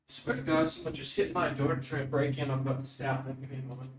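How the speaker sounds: quiet startled aside to the table; hushed alarm. Real, not acted. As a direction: murmur it so the intruder won't hear. GM hears someone breaking in.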